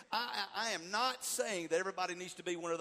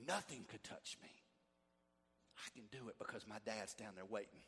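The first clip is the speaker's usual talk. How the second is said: quiet remembered thought; 10 semitones lower, 12 dB quieter than usual. Retold or imitated. Child's inner thoughts recalled.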